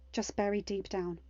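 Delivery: plain reading voice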